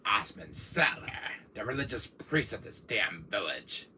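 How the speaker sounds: low, soft villain introduction; name him in a low, sinister hush; low menacing villain impression; Wesker voice. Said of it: "I'm gonna do my Wesker voice".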